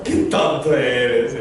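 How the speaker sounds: low, slow, teasing wife's voice; mock the husband fondly, slowly; a hint of a teasing wife. Wife's reply quoted.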